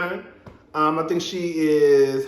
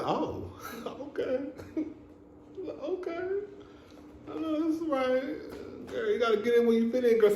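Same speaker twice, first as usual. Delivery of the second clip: high sassy reply; 10 semitones higher, 8 dB quieter than usual. Retold or imitated. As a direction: play it up like a sassy girlfriend. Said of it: "I said".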